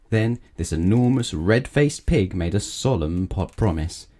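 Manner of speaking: ordinary delivery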